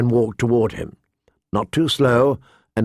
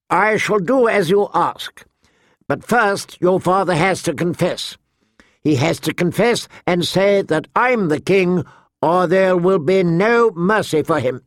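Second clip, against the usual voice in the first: higher, declaring voice; lightly voiced young king; 6 semitones higher than usual. King Joffrey sets terms for mercy, higher and declarative. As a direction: lay down the condition, pitched up. King grants plea on condition.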